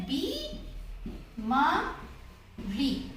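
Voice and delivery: बी मा री broken into slow syllables. Shown as a demonstration. Teacher breaks the word into syllables for children. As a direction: spell out बी, मा, री slowly with pauses. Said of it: "दोनों पर बड़ी ई की मात्रा".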